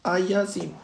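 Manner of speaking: usual speaking voice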